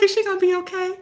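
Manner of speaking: high-pitched mock concern; retold worried question. Teacher repeating what she asked about her student, mock worried. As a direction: ask it high, imitating concern.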